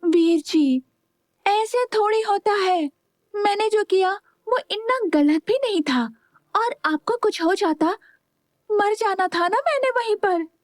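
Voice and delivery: put-on high, pleading girl's voice; a young sister's pleading voice. A narrator's reading. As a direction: beg him to forgive her.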